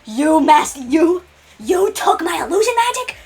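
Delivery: high, slow, stunned disbelief; shocked Kitten. Acted. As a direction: voice the villain aghast. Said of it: "Kitten's just like".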